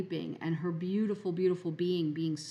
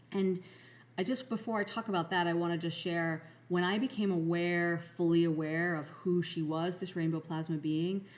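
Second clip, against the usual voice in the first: normal talking voice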